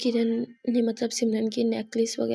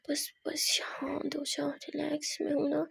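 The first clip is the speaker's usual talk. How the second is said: soothing whisper; 4 semitones higher, 7 dB quieter than usual. Acted. Kiran soothing crying Simran.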